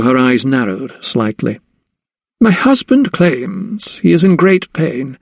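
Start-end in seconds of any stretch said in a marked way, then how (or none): 2.4-5.2 high, slow sneer with weight on "claims"; scornful lady, high and unhurried; sneering, stressing "claims". A narrator's reading. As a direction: draw out "claims" in haughty disbelief, pitched up. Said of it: "she sneered, emphasizing the word claims".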